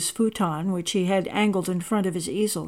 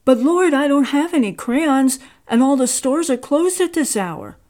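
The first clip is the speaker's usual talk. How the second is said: higher and louder, worried objection; a young man's anxious, raised plea; anxious protest; 6 semitones higher, 5 dB louder than usual. A narrator's reading. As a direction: fret that the stores are closed. Carl worried he lacks crayons.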